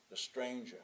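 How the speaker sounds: usual speaking voice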